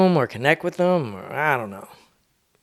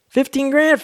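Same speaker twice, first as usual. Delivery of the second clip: a much higher, louder stunned question; shocked disbelief; 13 semitones higher, 4 dB louder than usual. Retold or imitated. Friend hearing the check amount, shocked high question. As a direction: gasp it out like you can't believe the number.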